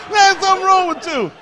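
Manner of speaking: high laughing voice; amused, laughing at the performer. Real, not acted. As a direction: talk through laughter. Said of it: "laughing at performer".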